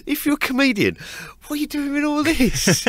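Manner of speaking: very high-pitched mock bafflement; incredulous cop. Cop challenging a comedian about his equipment, incredulous. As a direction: question it in squeaky disbelief, imitating a cop.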